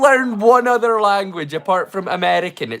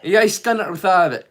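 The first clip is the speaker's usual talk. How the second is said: thick Doric Scots, lightly played; a hint of broad Doric dialect. Shown as a demonstration. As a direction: rattle it off in broad north-east Scots. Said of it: "You wouldn't understand the word I was saying".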